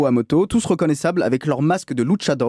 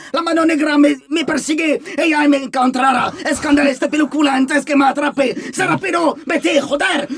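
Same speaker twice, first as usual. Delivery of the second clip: crazed Spanish rant; 11 semitones higher than usual. Retold or imitated. Madman grabbing him outside the store, shouting in Spanish. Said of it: "dans ce style".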